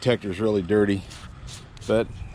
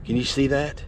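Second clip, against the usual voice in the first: ordinary talk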